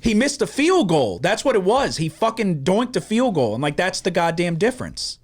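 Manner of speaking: worked-up venting; exasperated fan rant. Retold or imitated. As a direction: relive the outrage of a missed kick. Worked-up rant over missed field goal.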